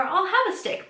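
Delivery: high mock Chandler; imitating Chandler. Host voicing Chandler's other option, high mock Chandler. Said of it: "he's like, could have said".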